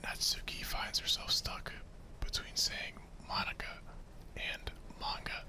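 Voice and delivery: soft breathy whisper telling the story; whispered narration. Acted. Narrator setting the club scene, whispered. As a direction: hush it like a secret bedtime story.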